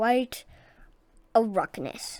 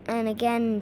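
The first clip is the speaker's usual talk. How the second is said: ordinary delivery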